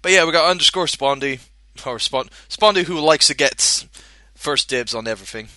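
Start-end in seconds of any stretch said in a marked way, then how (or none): none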